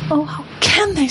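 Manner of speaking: put-on high tearful girl's plea; tearful Lucy. A narrator's reading. Lucy weeping as Aslan is mocked, high tearful. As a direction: choke it out through tears. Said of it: "tears streaming down her cheeks".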